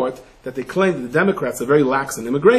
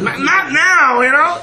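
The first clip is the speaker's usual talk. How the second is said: high, loud, laughing protest; 11 semitones higher, 5 dB louder, slower than usual. Retold or imitated. People in the shelter fed up, laughing high protest. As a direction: squeal it out, half laughing. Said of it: "You're like".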